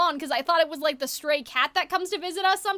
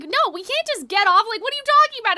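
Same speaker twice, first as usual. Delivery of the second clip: high, loud exasperated yelling at grandfather; angry outburst in a dream argument; angry protest; 6 semitones higher, 4 dB louder than usual. Retold or imitated. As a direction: push back hard, voice climbing and louder. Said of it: "And I was getting so angry".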